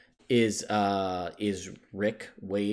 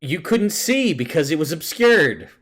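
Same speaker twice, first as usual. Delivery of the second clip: loud, higher, emphatic retort; raised-voice pointed rebuttal; emphatic objection; 6 semitones higher, 8 dB louder than usual. Real, not acted. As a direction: push back loudly on the player's logic. Teasing objection to player's claim.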